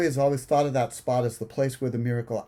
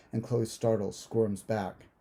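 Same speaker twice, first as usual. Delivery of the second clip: usual speaking voice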